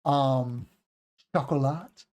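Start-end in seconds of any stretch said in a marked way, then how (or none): 1.3-2.1 "chocolat" French-style, shock-o-la; French pronunciation. Shown as a demonstration. Teacher wondering is that French, tentative French accent. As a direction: try a French-sounding chocolat. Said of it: "is that French?".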